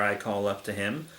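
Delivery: usual speaking voice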